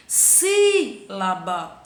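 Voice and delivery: Sílaba drawn out slowly, SI stretched; teacher stretching Sílaba syllable by syllable; stress on SI. Shown as a demonstration. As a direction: hold SI long to show the stressed syllable. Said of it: "Si é a minha sílaba tônica".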